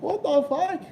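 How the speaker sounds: high, disbelieving what the fuck; incredulous. Real, not acted. Streamer recalling Barney to chat, incredulous. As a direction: pitch leaps up in disbelief.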